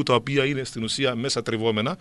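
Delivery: ordinary delivery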